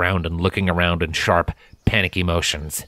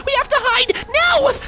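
put-on very high child voice, pushing harder on Now!; frantic, dragging her away; panicked near-scream. A narrator's reading. Primrose grabs Scarlet's hoof, panicked high voice.

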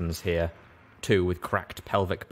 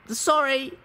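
grimacing apology. Acted. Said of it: "I said with a grimace".

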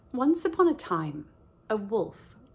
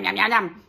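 nom nom eating; 5 dB louder than usual. Acted. Wolf eats the lamb.

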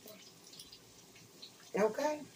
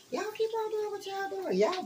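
high taunting voice; 12 semitones higher than usual. Pretend.

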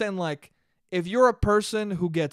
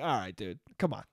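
low, soft, let-it-go reply; easygoing acceptance; 9 semitones lower, 8 dB quieter than usual. Acted. Man forgiving someone who admitted teasing him, easygoing acceptance. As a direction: drop it quietly and pitch it low.

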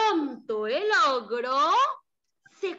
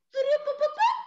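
magic sound; 11 semitones higher than usual. Acted. Transformation sound effect.

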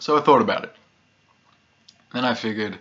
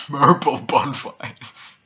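high, loud, slow words through laughter; cracking up; 5 semitones higher, 5 dB louder, much slower than usual. Acted. Pyromaniac pictures the cats running round the backyard, cracking up.

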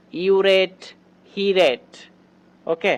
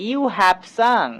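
very slow, stretching sung; slow model sentence; 3 semitones higher, much slower than usual. Shown as a demonstration. Teacher telling students to notice how she says it. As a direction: draw every word out for the class. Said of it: "খেয়াল করো কিভাবে আমি এগুলো ইংরেজিতে বলছি".

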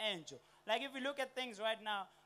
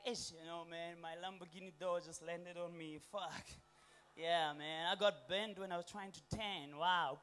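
bored rich guy complaining about luxury problems; rich person voice; 6 semitones lower, 5 dB quieter than usual. Retold or imitated. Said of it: "Rich people have different injuries".

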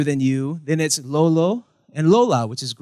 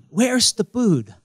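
lightly acted question, higher and drawn out; acted asker; 6 semitones higher, slower than usual. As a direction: slowly ask it in a raised mock voice. Acted question in lip-pointing skit.

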